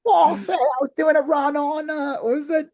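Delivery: high-pitched put-on Cockney; Cockney British. Candidate's real voice in commentary, Cockney accent. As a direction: play a chatty Cockney actor. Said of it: "it's like Cockney British".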